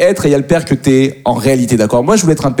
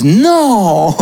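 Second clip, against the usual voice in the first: long « non » haut perché, faussement horrifié; stupeur jouée; 6 semitones higher than usual. Acted. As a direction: feins l'horreur en étirant le cri. Père feint l'horreur devant l'alibi. (un « non » théâtral, incrédule et aigu)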